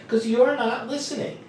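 pitched high, insistent NOT LISTENING; high, pressed explanation with weight on NOT; emphatic. Acted. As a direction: reproach them for NOT LISTENING. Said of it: "why am I putting this emphasis".

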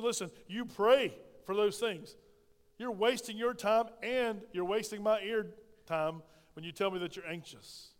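usual speaking voice

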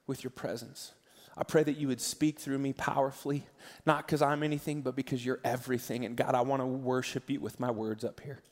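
soft, hushed prayer to God; earnest prayer. Acted. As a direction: pray quietly and sincerely, drawing the room in. Pastor leads congregation in prayer.

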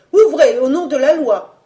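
high, loud official demand; shouted police order. A narrator's reading. Policemen shouting at the apartment door, a commanding cry. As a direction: call it out as through a door. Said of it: "des voix qui criaient".

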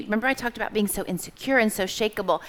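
usual speaking voice

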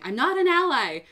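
high, loud indignant yell; retold yell. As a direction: yell it out in protest. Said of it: "the awkward position of yelling".

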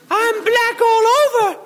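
high, slow mock wail; crying out. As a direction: wail like a shocked puritan woman. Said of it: "cried Goody Proctor".

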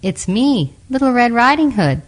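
high, put-on little girl voice; disguised wolf. A narrator's reading. Wolf at Grandma's door, faking a high girlish voice. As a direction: pitch it up to pass as a girl. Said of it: "trying to hide his gruff voice".

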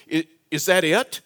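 normal talking voice

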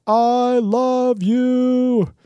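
high, loud, slow gushing affection; dragged-out loud declaration of love; gushing to a pylon. Retold or imitated. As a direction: pour adoration onto the pylon. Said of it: "I pick up those pylons and say".

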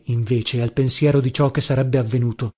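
ordinary delivery, nothing marked

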